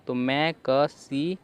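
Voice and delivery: मैं क सी sounded out slowly in parts; syllable by syllable. Shown as a demonstration. Teacher sounding out मैं क सी for students. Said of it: "म पढ़ रहे हैं".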